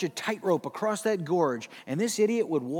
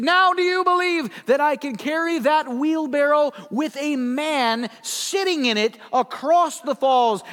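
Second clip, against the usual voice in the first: showman calling out; 8 semitones higher, 7 dB louder than usual. Retold or imitated. Blondin daring the crowd, booming showman. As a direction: build the dare like a ringmaster.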